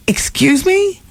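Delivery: high, outraged "excuse me"; shocked. Retold or imitated. Wife hearing Tom tracked her, shocked outrage. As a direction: hit it like a slap of disbelief. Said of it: "And she was like".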